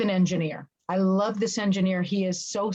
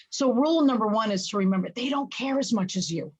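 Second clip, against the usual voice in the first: ordinary talk, nothing marked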